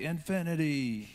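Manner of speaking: put-on high, slow, drawn-out "Infinity" like a little girl; child's delighted answer. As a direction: stretch out "Infinity" the way his daughter says it. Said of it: "And they'll say".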